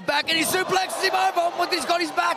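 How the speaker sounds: yelling at the big moment; excited shout. Real, not acted. Commentator yelling at big move.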